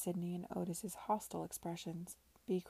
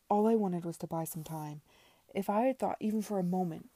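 loud, urgent self-justification; raised-voice, flustered protest; desperate excuse; 8 dB louder than usual. A narrator's reading. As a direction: plead your case forcefully. Debtor desperately excuses himself.

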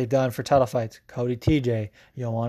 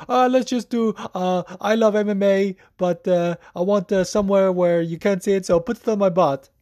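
higher Swedish accent impression; 5 semitones higher than usual. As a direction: retell Jack's words in a Swedish accent. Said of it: "So he was like".

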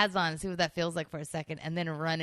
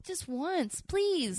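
pleading; 10 semitones higher, much slower than usual. Real, not acted. Begging to wear football pads.

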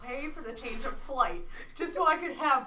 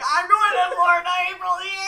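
much higher and louder, rushing disbelief; mock panic; 10 semitones higher, 14 dB louder than usual. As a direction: squeal at the coincidence. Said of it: "And I'm like".

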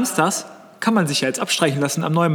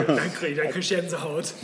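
laughing; 7 dB quieter than usual. Real, not acted. Joking about the name.

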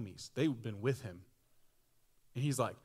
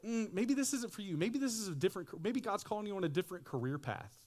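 1 discouraging family; 8 semitones higher than usual. Retold or imitated. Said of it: "They say".

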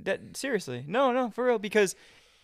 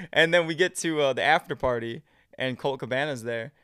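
usual speaking voice

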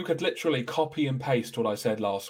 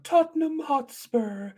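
high, slow, drawn out name; high mock announcer; 14 semitones higher, slower than usual. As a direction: sing out the club name crudely, high. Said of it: "just like".

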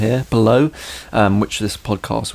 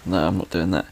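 ordinary talk, nothing marked

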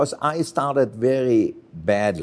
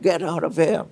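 high-pitched mock order to leave; retold shout; 6 semitones higher than usual. Chef throwing the boy out, pitched-up mock yell. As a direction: snap him out of the room, lightly acted. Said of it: "and screamed at me".

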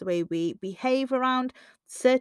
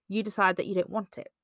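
ordinary talk, nothing marked

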